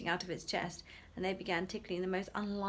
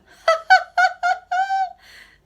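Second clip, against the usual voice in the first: very high loud giggling fit; gleeful child cackle; boy's helpless laughter; 19 dB louder than usual. Acted. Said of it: "exploded into helpless laughter".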